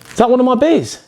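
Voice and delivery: high, outraged question; indignant at the stolen beer. Acted. Spots his beer used as prop.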